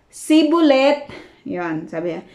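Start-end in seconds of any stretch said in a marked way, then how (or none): 0.1-1.1 Sibulet said slowly and higher, plain s in place of sh; wrong Shibboleth. Retold or imitated. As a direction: mimic the failed Shibboleth as Sibulet. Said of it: "sasabihin nila". ("Sibulet" na mabagal at mali ang bigkas)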